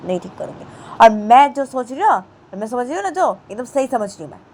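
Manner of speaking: loud angry refusal; defiant refusal. Acted. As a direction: snap back in stubborn anger. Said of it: "तो रामा बोलती है".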